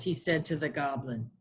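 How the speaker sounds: plain reading voice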